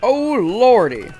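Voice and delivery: drawn-out loud groan of dread; dismayed. Real, not acted. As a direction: stretch it out in alarm. Grenade about to hit.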